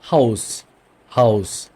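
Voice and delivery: হাউস as 'house' with a sounded H; German pronunciation. Shown as a demonstration. Teacher demonstrates German H in a word, হাউস as 'house'. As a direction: model হাউস as a separate, falling word. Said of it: "প্রনাউন্সিয়েশনটা একটা German word দিয়ে দেখি".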